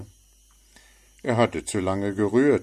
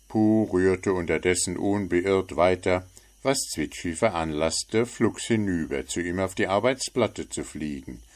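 usual speaking voice

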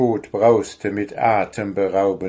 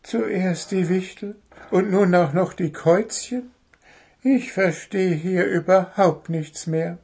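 high little bird voice, puzzled; confused chirp to oneself; bird's bewildered thought; 9 semitones higher than usual. Acted. Little bird thinks, utterly bewildered. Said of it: "Der kleine Vogel dachte".

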